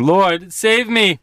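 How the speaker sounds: high, slow plea for rescue; dramatized cry for help from someone sinking. A narrator's reading. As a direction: call out desperately, stretching the words. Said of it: "he cried out".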